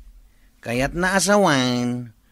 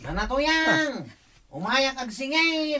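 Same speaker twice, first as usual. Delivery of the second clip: high call heard from afar; far-off high call to the house; calling from outside; 8 semitones higher, 7 dB quieter than usual. Acted.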